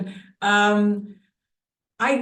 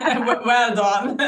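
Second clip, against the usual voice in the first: slow, laughing through well done; amused praise with a real laugh; 2 semitones higher, 3 dB louder, slower than usual. Real, not acted. Guest praising host's accent effort, laughing.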